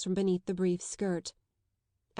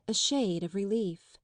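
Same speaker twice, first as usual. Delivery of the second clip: ordinary delivery, nothing marked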